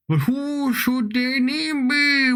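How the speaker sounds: high, slow, stretched-out question; drawn-out musing. Pretend. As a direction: draw out every word high in mock pondering. Playful repeat of naming question.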